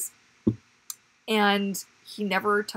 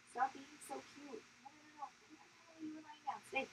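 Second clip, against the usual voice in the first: high soft cooing; 7 semitones higher, 20 dB quieter, much faster than usual. Real, not acted. Cooing at baby.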